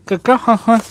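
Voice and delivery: high, sing-song praise for the dog; dog talk. Real, not acted. Petting the dog, cooing to her.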